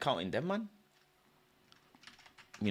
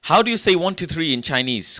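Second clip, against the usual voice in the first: excited; 7 semitones higher, 10 dB louder than usual. Real, not acted. Excited question to friend.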